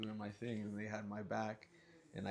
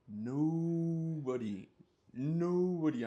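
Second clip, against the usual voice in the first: loud, slow drawn-out nobody; slow, louder insistence; emphatic nobody; 6 semitones higher, 7 dB louder, much slower than usual. Real, not acted. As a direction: stress that nobody came. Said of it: "emphatic repeat".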